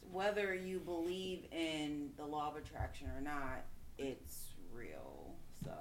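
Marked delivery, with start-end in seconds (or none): 4.0-5.3 breathy unvoiced whisper. Real, not acted. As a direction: hush it like a secret. Emphatic closing point, hushed.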